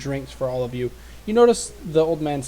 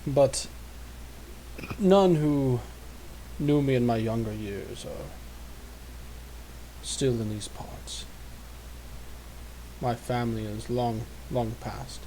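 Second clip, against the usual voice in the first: put-on old man voice. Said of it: "my best old man voice".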